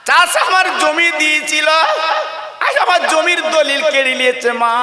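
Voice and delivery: a son weeping to his mother over what was taken; wailing son. Acted. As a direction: wail out the loss to mother. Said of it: "ছেলে কেঁদে কেঁদে বলছে".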